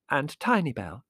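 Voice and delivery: a hint of the interrupted niece; lightly voiced niece. A narrator's reading. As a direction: carry on the list, lightly voiced. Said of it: "said Prue".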